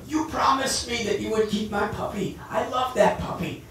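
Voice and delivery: put-on a child's loud hurt protest; angry child complaint. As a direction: scold her for breaking a promise.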